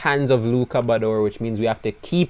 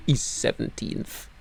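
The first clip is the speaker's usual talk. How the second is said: whispered, slow and defeated; deflated whisper; 11 dB quieter, slower than usual. Real, not acted. Team boss reading the standings, slow defeated whisper. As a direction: breathe it out in disbelief. Deflated after Bador drops.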